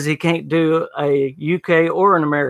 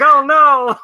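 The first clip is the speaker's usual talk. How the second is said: high, loud exclamation of dismay; floored; 10 semitones higher, 6 dB louder than usual. Retold or imitated. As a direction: exclaim it as if knocked back. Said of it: "it just floored me".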